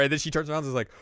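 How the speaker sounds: ordinary talk, nothing marked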